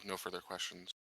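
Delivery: ordinary delivery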